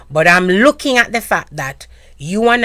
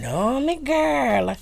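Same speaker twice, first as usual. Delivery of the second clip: slow, soft Jamaican patois; 8 semitones higher, 5 dB quieter, much slower than usual. Retold or imitated. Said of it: "you hear my dialect".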